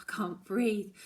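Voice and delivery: plain reading voice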